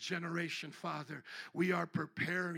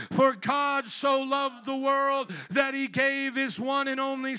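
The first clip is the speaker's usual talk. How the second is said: full-force preaching; 6 semitones higher, 13 dB louder than usual. Real, not acted. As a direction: thunder it out to the congregation. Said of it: "let's say it together".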